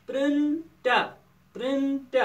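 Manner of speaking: "Print" held high, then a break before the ending; syllable by syllable. Shown as a demonstration. Teacher sounding out syllables, "print" high and held apart. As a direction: sound out "print" as prin, gap, ta. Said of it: "print چھِ اَکھ syllable division".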